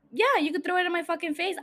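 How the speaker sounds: ordinary talk, nothing marked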